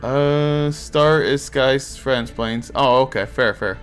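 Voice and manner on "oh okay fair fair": normal talking voice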